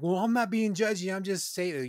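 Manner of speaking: high, loud mock defensive protest; defensive whine. Mocking friends who deny being judgy, high defensive squeak.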